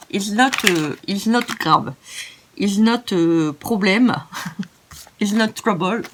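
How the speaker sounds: put-on French-accented Franglais, searching for words; jokey broken English. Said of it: "it's not correct, euh, l'anglish". (franglais hésitant, accent français)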